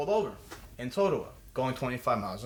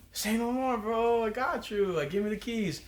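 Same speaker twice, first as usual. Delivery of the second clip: higher-pitched, eager quote of himself; cocky retold eagerness; 9 semitones higher, slower than usual. Driver telling Jerry he's got him, eagerly cocky. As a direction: play up the easy confidence in a higher voice.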